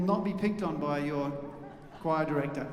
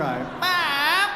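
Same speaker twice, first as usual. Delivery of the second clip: louder, more annoying twangy call of Mom; annoying twang; 10 semitones higher, 7 dB louder than usual. Shown as a demonstration. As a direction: whine Mom as annoyingly as a kid. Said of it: "You can be far, far more annoying than that".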